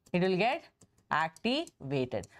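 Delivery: "activated" drawn out long and low; slow right down and stretch "activated" low; slow drawn-out emphasis. Shown as a demonstration. Teacher to students, stretching "activated" slow and low. Teacher repeating key point.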